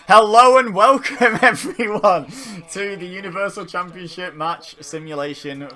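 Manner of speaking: big, slow show-host welcome; announcer intro. Acted. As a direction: announce it like a wrestling broadcast.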